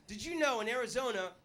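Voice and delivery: ordinary delivery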